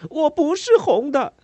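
high, loud cry through angry tears; tearful angry shout. A narrator's reading. Boy shouting at his mother through angry tears. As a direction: shout it out, fighting back tears. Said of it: "我咽下愤怒的泪水喊道".

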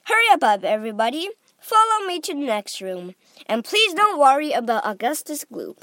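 loud rallying of the group; crying out. A narrator's reading. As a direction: guide chivvying everyone along. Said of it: "cried Mister Wonka".

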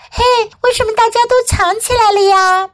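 high, quick little bug voice; curious baby bug. Acted. Baby bug asks why everyone hides, high and curious. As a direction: call out the question in a high child-like voice.

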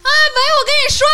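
very high, loud mock phone voice; exaggerated high-pitched phone yell; imitating loud phone talker. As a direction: screech into the phone like an oblivious loud caller. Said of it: "打电话的声音大概是这样".

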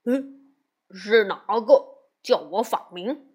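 lightly voiced lazy pig monk; a hint of grumbling Bajie; Bajie's voice. A narrator's reading.

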